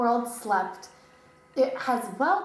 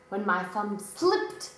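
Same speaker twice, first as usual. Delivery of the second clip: ordinary delivery, nothing marked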